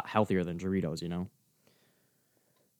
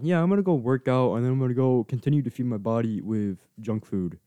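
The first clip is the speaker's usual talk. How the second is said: mocking; 2 semitones higher than usual. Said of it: "how people think like that".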